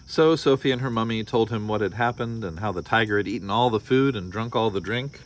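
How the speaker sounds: storybook read-aloud with voiced characters; story narration. A narrator's reading. Parent reading a picture book to listeners, lively storytelling. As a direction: read it like bedtime story time.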